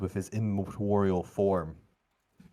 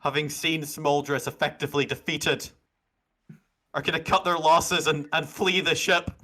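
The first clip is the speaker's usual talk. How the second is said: urgent narration; 8 semitones higher, 5 dB louder than usual. A narrator's reading. GM narrates fleeing.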